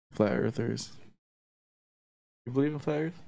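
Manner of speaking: normal talking voice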